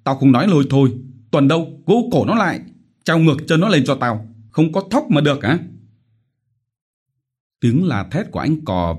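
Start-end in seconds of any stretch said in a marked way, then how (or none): 0.0-5.6 furious yell, higher and louder. Acted. Village chief yelling at debtors, furious shouting. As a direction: bellow orders at the peasants.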